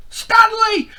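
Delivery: very high, very loud shout of the friend; shouting his name. Acted. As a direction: call out his name across the water. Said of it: "his friend shouted".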